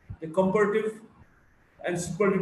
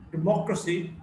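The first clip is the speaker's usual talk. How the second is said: democracy as de-MOC-racy; democracy, weight on the second syllable; shifting-stress demo. Shown as a demonstration. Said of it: "stress shifts depending on the morphological structure".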